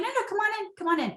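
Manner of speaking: high, eager welcome: "No, no, come on in"; welcoming invite. Retold or imitated. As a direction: lift the pitch way up to welcome him in. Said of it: "I'm like".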